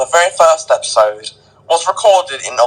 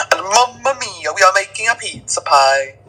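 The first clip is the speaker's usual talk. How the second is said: higher pitched hammed-up Italian chef accent; 6 semitones higher than usual. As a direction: play a cheesy Italian pizza chef. Italian chef act, pizza, moustache.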